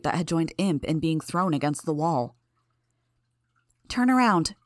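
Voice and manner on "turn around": sharp, higher-pitched command; raised-pitch brisk order. A narrator's reading. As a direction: order them to turn, firmly. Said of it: "Tattletale ordered Tecton and Grace".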